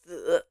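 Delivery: "zi" in een lage derde toon; third tone. Shown as a demonstration. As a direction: say "zi" drawn out in a low, dipping Mandarin third tone. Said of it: "in the third tone".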